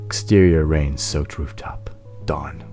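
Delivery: ordinary talk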